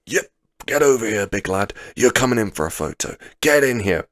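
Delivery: re-enacted rowdy yell, big and insistent; boisterous shout. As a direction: call your mate over at full volume. Retelling calling friend over for photo.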